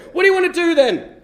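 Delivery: high, loud acted demand; mock fed-up yell; exasperated shout. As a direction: snap back at a pestering drunk. Turns on the sailor, exasperated.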